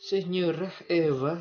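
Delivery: high, loud, slow arrivederci; Italian accent. Shown as a demonstration. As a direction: sing out arrivederci Italian style. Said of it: "sudah bisa logat Italia saya".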